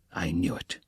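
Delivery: hissed under breath in vexation; vexed whisper. A narrator's reading. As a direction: whisper it, annoyed. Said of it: "cried Porfiri with vexation".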